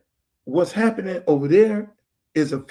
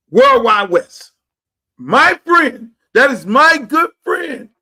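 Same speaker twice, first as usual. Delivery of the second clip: loud, higher, slow excited greeting; excited shout-out; 6 semitones higher, 8 dB louder, slower than usual. Real, not acted. Host spots his friend on screen, loud excited shout-out.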